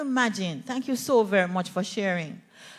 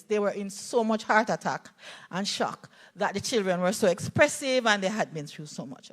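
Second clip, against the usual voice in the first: ordinary delivery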